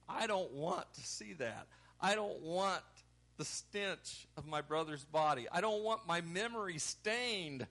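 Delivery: acted, pained pleading with the Lord; distraught protest. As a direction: protest urgently, emphatic on each refusal. Voices Martha protesting to Jesus.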